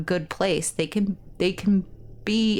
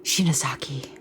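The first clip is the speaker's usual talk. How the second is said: whisper; 4 semitones lower than usual. Real, not acted. Whispering character's name.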